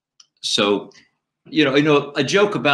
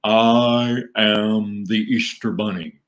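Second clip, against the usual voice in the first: slow, drawn-out puppet proclamation; rabbit puppet; slower than usual. Acted. Rabbit puppet introduces himself at Easter, slow grand puppet voice. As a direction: announce yourself grandly, word by word. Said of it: "And he would go".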